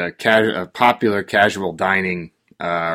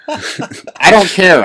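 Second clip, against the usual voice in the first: laughing defiance; 7 semitones higher, 4 dB louder, much slower than usual. Real, not acted. Laughing through rant.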